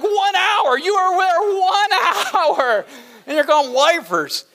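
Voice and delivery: high, laughing mock outrage; amused dad teasing his son's new accent, laughing; laughing disbelief. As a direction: laugh through the teasing question.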